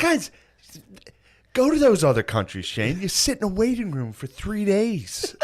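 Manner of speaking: high, worked-up lecturing voice; exasperated dad. Retold or imitated. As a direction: imitate a dad ranting at his son. Imitates Phil arguing about healthcare.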